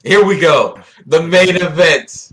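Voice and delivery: loud, high, drawn-out ring announcement; mock event announcer. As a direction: hype it up like the headline bout. Announcing the next topic like a fight.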